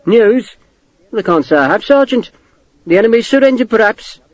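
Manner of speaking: mock the sergeant with lofty sarcasm; a hint of a sneering captain; sardonic officer. A narrator's reading. Morris's sardonic reply, raised voice.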